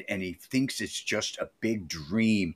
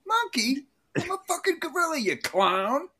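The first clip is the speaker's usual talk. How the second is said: much higher mock cartoon voice, squeaking the insult; Belushi's gorilla line; 13 semitones higher than usual. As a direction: squeal it like a guy stuck in a gorilla suit. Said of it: "we get some really great Jim Belushi here".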